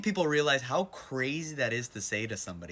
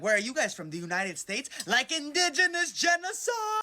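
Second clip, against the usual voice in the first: mock gushing girl; 12 semitones higher than usual. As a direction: parrot their tone back at them. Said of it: "I'm like".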